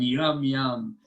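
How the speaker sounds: ordinary delivery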